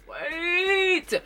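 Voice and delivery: high squeal on wait; excited. Real, not acted. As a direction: squeal with eagerness.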